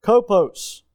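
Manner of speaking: "Kopos" spoken deliberately for the class; Greek word Kopos. Shown as a demonstration. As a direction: say "Kopos" as KO-pos, stressing the first syllable. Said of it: "the third word we're gonna see is kopos".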